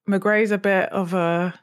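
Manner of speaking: ordinary talk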